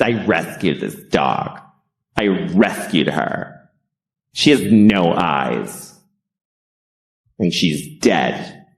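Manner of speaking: mock the New York rescuer, slow and smug; smug New York dog rescuer. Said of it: "everybody rescues their dogs like this".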